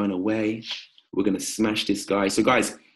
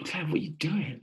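a hint of a mocking voice; 6 semitones higher, 7 dB quieter than usual. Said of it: "Claire said, that voice".

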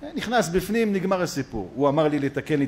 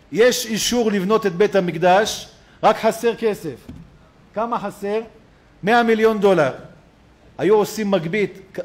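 raised, ringing sermon voice; preaching; 6 semitones higher, 5 dB louder than usual. Acted. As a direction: rouse the congregation with the teaching. Preacher cites the Chafetz Chaim.

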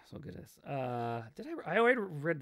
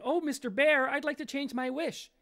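higher, flustered plea; panicked pie maker; 11 semitones higher, 5 dB louder than usual. Acted. Third man begging the vanished bear, higher and flustered.

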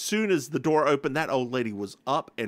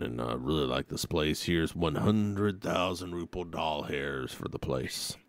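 much lower, slow, drawn out game character; 10 semitones lower, 6 dB quieter, slower than usual. Pretend. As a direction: drop way down and drawl as the character.

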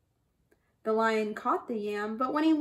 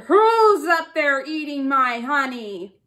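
a touch louder lion growl, lightly voiced; 7 dB louder than usual. A narrator's reading. Lion catches thieves in his tree, mild growl. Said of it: "He growled".

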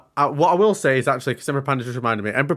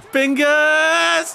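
loud high cheer; excited shout; 6 dB louder than usual. Real, not acted. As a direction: scream the name in excitement. Cheering wrestler's kick-out.

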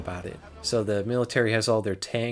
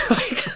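high laugh; 5 dB louder than usual. Real, not acted. Laughs at his own joke.

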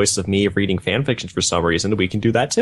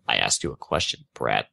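much lower and softer, a cold threat; cold soldier's voice; 10 semitones lower, 4 dB quieter than usual. Acted. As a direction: speak down to the boy like a menacing soldier.

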